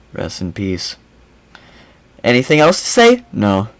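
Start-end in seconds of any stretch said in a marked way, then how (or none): 0.1-1.0 low, very quiet, flat mock condolence; deadpan monotone. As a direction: mumble it without a flicker of feeling. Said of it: "And here she is like".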